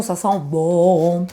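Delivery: low, very slow and drawn out; savouring good cards. Real, not acted. Reader savouring the viewer's good cards, low drawn-out voice. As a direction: stretch it out low, relishing it. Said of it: "je parle comme ça".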